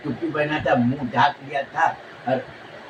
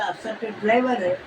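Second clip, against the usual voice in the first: lightly voiced father, higher and puzzled; a father wondering where the money came from; a hint of a sceptical father; father's doubting voice; 5 semitones higher than usual. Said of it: "अब उसका बाप बोला".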